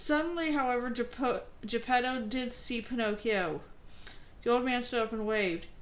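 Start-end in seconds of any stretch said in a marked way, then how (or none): none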